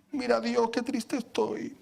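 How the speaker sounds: slow, hammed-up sad act; mock self-pity. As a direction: put on a pathetic, woe-is-me plea. Mock self-pity shown to God.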